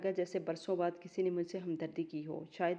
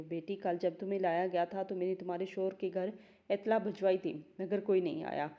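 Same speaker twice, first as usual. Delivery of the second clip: usual speaking voice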